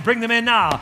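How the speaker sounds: ordinary talk, nothing marked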